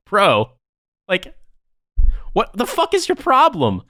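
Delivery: high-pitched, indignant disbelief, retold; incredulous indignation. Retelling his comeback to the lieutenant, incredulous. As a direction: demand an answer in a high, exasperated voice.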